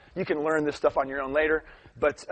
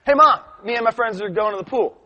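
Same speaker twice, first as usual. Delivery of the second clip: higher, louder imitation of a child; kid calling Mom; 7 semitones higher, 6 dB louder than usual. Kids telling Mom about the pool, calling out. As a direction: call out eagerly like a kid.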